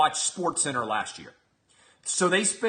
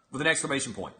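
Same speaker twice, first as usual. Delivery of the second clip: usual speaking voice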